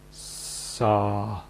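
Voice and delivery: "sa" with S merged smoothly into the vowel; blended S. Shown as a demonstration. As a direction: glide "sa" into one seamless syllable. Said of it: "blend the two sounds together until they are seamless".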